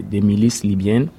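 usual speaking voice

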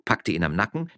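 ordinary delivery, nothing marked

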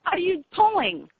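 sudden outraged outburst, pitch jumps up; angry disbelief at the caller. Real, not acted. Caller upsets her son.

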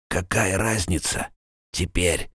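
faint rasping whisper; pained dying rasp. A narrator's reading. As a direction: barely breathe the words out. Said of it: "Слова текли мучительно и медленно".

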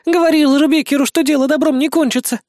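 high, scared woman's voice; frightened sob. A narrator's reading. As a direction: protest in a high, tearful panic. Terrified woman held at gunpoint.

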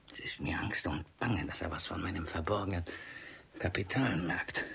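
low, soft voiceless aside; anxious aside whisper. Acted. Miser frets aside about his hidden money, whispering. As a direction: mutter it fearfully to yourself.

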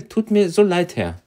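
normal talking voice

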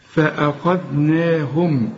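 فأخذناهم read slowly and evenly; measured tajweed فأخذناهم; slow recitation. Shown as a demonstration. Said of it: "هنا ما نقولش فأخذناهم".

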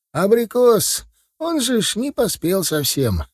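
high-pitched village grandad; objecting old man. Acted. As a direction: protest like an old countryman, voice up high. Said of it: "возразил дед, потирая шею". (дед спорит высоким голосом)

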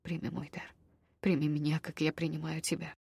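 soft, hushed vow; a quiet vow full of tenderness; doomed tenderness. A narrator's reading. Elven vow ritual.

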